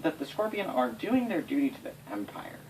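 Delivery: normal talking voice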